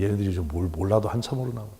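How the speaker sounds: ordinary talk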